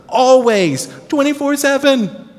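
Always shouted high and loud; emphatic always. Real, not acted. Preacher stressing Always to the congregation, loud and high. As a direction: hammer home Always.

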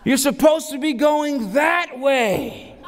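high, slow shouted correction; coach yelling. Retold or imitated. Coach redirecting the six-year-olds, high slow shout. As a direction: shout it down the field.

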